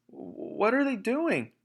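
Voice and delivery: high, slow, put-on child voice; scared little voice asking; frightened. Small foxes asking in fear underground.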